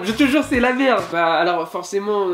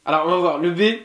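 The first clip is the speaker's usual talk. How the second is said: normal talking voice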